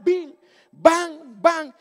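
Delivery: loud, high "bim, bam" bangs; imitated stone impacts. Stones hitting the fleeing van, imitated bangs. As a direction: punch out each hit like flying rocks.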